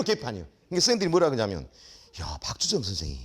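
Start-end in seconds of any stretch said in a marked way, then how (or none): 2.2-3.2 a lightly voiced mocking fellow teacher, lower; teasing colleague. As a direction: tease him like a smug coworker.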